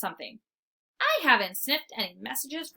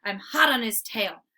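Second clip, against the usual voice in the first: usual speaking voice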